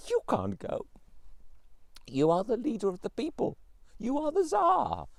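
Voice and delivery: pleading Tsarina. A narrator's reading. Tsarina begging her husband not to fight, high pleading. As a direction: plead with him, rising in alarm.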